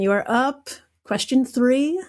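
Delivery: ordinary delivery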